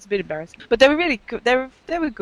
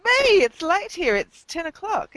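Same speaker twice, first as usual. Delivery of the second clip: excited; 10 semitones higher, 5 dB louder than usual. Real, not acted. Confessing gleefully to drinking.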